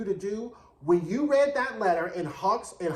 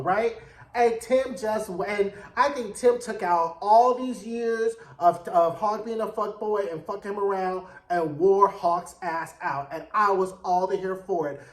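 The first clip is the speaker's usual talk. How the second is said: usual speaking voice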